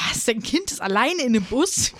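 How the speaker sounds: high, shocked question; shocked disbelief. Retold or imitated. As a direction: gasp at the news in disbelief. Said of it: "Und die Leute so".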